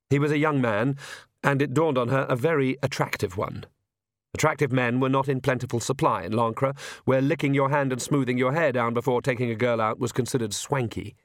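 usual speaking voice

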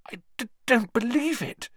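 very high, stammering, hushed; shocked disbelief. Acted. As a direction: voice breaking in horror. Hermione in shock after execution.

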